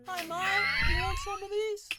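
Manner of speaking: loud, high shout across a field; calling out. Acted. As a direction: holler to get the dinosaur's attention. Calls out to dinosaur.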